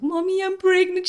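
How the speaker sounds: high, whimpering mock-cry to mommy; acted-out tearful daughter; retold tearful confession. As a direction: break the news in a high little wail. Retelling tearful call to mom.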